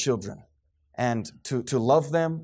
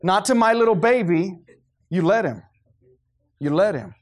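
hammed-up protective mama, a bit louder; mock doting mother; 3 semitones higher, 4 dB louder than usual. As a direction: play the mother shielding her baby boy. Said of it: "be like".

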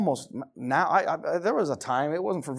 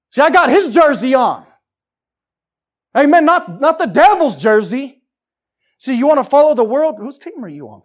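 loud, high mock cheering for the devil's team; preaching at full force; 11 semitones higher, 15 dB louder than usual. Preacher mocking the congregation's worldly cheering, loud and sarcastic. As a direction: ham up a sarcastic fan chant, loud and high.

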